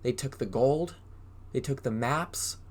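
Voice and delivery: usual speaking voice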